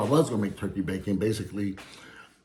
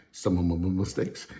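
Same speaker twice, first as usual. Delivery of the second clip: ordinary delivery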